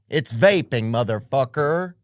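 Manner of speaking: ordinary delivery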